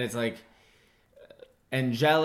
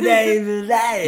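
Watto; 10 semitones higher, 10 dB louder, slower than usual. Acted.

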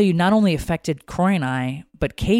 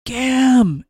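raised, louder cry of a name; retold scream; 10 semitones higher, 7 dB louder than usual. Recalling the unforgettable scream, a restrained retold yell. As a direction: imitate a scream at partial strength. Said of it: "I'll never forget the scream".

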